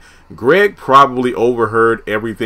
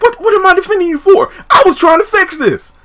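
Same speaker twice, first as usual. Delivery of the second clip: high, fast baffled protest; high exasperated comeback; incredulous Tom; 3 dB louder than usual. Retold or imitated. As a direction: pitch it up, defending yourself. Tom answering Pam's accusation.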